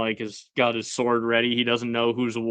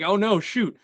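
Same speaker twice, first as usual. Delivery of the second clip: high, hurried mock panic, rushing at the end; a parent scrambling before the cops come, half-joking; imitating a parent frantically covering up a kid's mess; panicked parent; 6 semitones higher than usual. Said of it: "a parent trying to hide the evidence".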